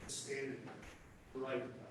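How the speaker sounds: low, hushed build-up to the reveal; hushed dramatic. Acted.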